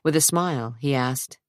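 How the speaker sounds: ordinary delivery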